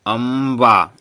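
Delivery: अम्बा loud, the m held long; drawn-out pronunciation. Shown as a demonstration. Teacher checks the m is heard, अम्बा stretched out. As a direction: push out अम्बा slowly in two long syllables. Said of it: "मो आ रहा है".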